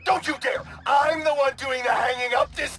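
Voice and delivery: indignant shout. Acted. Helis refuses to let the hero hang up, outraged shout. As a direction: insist on hanging up first, outraged. Villain insists on hanging up.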